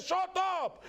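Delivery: high-pitched mock yell; bossy son. Little son silencing armed robbers, high angry yell. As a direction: squeal it as a fierce order.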